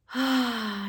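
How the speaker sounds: big audible breath sigh. Shown as a demonstration. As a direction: sigh it out, loud and open. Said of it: "So just breathing in".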